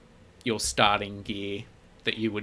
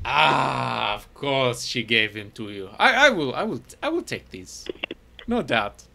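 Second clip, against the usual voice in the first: scheming shopkeeper; 3 semitones higher, 8 dB louder than usual. Acted. Shopkeeper pocketing Ivar's pots for resale, in a greedy, gleeful voice. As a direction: savour the deal with glee.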